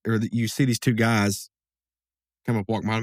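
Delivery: ordinary talk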